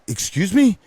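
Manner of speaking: high, sharply rising disbelief; taken aback. Retold or imitated. DJ answers a pushy wedding guest, taken aback. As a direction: retell it as an affronted question.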